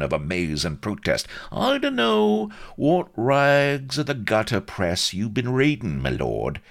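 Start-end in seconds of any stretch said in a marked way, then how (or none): 1.5-6.6 higher-pitched, scolding Cockney; severe reproof. A narrator's reading. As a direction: severely take his lordship to task in Cockney. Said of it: "he added severely".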